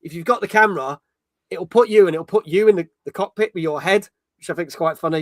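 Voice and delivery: ordinary talk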